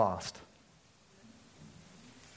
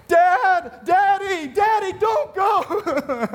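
put-on high, loud child's wail, sobbing Daddy; a kid left behind, screaming after the truck; crying out for dad; 6 dB louder, much slower than usual. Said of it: "I start crying".

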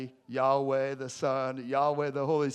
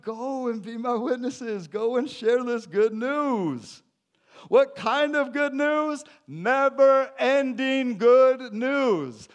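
raised, slow, ringing proclamation, far above his talking voice; preaching at full force; 10 semitones higher, 7 dB louder, slower than usual. Acted. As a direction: proclaim it to the whole room, drawing out each phrase. Preacher voicing Jesus' commission.

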